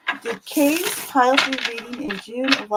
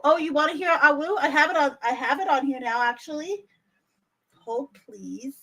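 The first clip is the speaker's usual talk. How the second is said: normal talking voice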